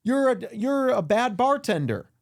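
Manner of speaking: high whiny accuser voice; mock accuser. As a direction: whine the accusation in a high mocking voice. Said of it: "And then".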